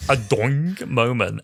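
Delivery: slow, stretched cartoon "doing"; mock cartoon sound cue; comic sting. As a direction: draw out the sting like a spring boinging. Mimicking a cutaway gag sting.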